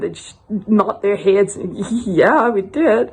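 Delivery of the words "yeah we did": mock giggly couple, slow; imitated sheepish giggling. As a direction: answer slowly through a put-on giggle. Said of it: "they just giggle".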